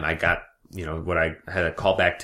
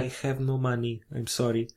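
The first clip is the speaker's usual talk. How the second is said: higher, halting put-on foreign voice; foreign DMV customer; 5 semitones higher than usual. Customer confirming details to a DMV clerk, hesitant foreign voice. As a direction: play a hesitant foreigner at the counter.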